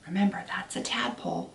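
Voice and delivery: ordinary delivery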